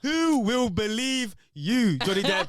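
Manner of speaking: high, slow, drawn-out sneer; slow taunting voice. Retold or imitated. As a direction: stretch it out like a cruel taunt. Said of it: "She's literally like".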